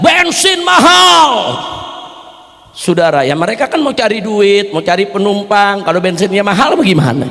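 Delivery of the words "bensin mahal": high, slow mock protest yell; drivers shouting protest. Preacher imitating motorbike taxi drivers protesting fuel prices, high slow yell. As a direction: shout it like ojek drivers at a demo. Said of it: "ojol teriak protes".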